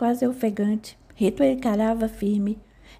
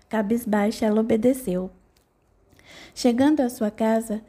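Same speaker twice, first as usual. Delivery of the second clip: ordinary delivery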